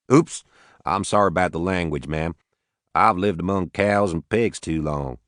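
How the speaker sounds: put-on easygoing Arkansas farmer, Southern accent. Max to the Watanabes, a light Southern accent. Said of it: "He had a heavy southern accent".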